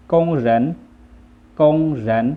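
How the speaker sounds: กงเหริน as loud Chinese, high first syllable; Chinese pronunciation. Shown as a demonstration. Teacher models the word for worker, Chinese pronunciation of กงเหริน. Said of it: "ภาษาจีนอ่านว่า".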